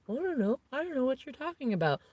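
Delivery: high, feigned innocent whine; mock playing dumb. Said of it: "and then go".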